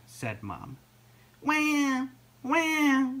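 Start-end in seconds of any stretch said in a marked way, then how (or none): 1.4-3.1 high, drawn-out imitated wail; baby seal cry. A narrator's reading. Lost baby seal crying from the beach, high wail. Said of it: "cried the baby seal".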